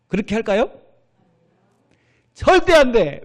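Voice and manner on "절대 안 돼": emphatic no. Real, not acted. Preacher answering his own question.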